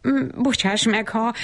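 mildly confused, broken-off sorry; flustered stammer. A narrator's reading. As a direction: falter through an apology, lightly embarrassed. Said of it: "dadogott zavartan Bab Berci".

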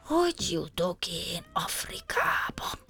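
slow, breathy whispered lament; whispered worry. A narrator's reading. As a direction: ask it softly, almost to himself. Lost hero whispers his worry. (suttogó, lassú, szomorú kérdés)

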